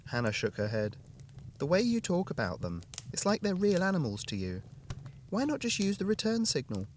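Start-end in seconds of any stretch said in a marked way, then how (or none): 1.6-6.9 higher woman's voice, gently questioning; wife voice. A narrator's reading. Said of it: "Hannah questioning John".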